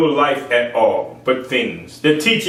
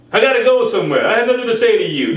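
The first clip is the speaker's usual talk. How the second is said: mock dismissive spouse; 7 semitones higher, faster than usual.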